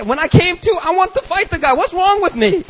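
very high-pitched self-reproach; worked-up, incredulous rise; exasperated disbelief. Acted. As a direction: question your own sanity. Exasperated at his own behavior.

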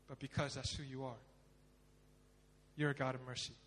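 low, hushed penitent prayer; pleading prayer. Acted. Sinner asks God for mercy, hushed pleading. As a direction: plead softly and low, leaving pauses between phrases.

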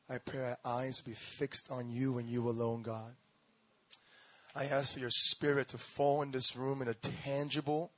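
ordinary talk, nothing marked

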